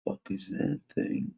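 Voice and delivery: hushed, breathy whisper at something strange. Real, not acted. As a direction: whisper it, half spooked. Staring at something on screen.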